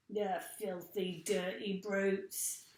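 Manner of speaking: ordinary delivery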